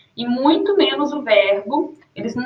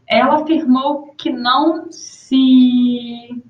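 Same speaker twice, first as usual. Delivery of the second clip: trailing off; much slower than usual. Shown as a demonstration. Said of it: "ele se interrompe".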